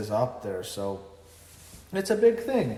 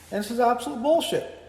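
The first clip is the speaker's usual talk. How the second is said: higher, louder outburst of disgust; exasperated rant; 11 semitones higher, 4 dB louder than usual. Real, not acted. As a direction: burst out in disbelief at how bad it was. Ranting about a bad match.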